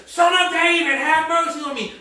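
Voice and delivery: loud, high cry for mercy; desperate re-enacted shout; blind Bartimaeus. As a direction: call out over the crowd, high and forceful. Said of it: "people telling you to keep it down".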